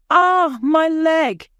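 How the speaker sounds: high, loud, slow pained cry; hurt child crying out. Acted. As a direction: wince out the words as the leg hurts. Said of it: "he cries".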